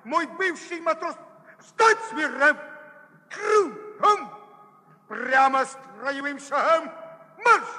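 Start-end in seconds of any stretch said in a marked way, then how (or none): 1.6-7.6 barked parade-ground commands; shouting orders. Acted. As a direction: yell drill orders at a sailor. Ex-boatswain orders former sailor.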